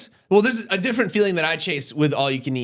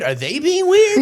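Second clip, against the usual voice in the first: slow, much higher and louder; high paranoid wondering; 11 semitones higher, 4 dB louder, slower than usual. Retold or imitated. Stoned guy asking himself if others are weird, high and slow. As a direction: voice a stoned worry climbing up.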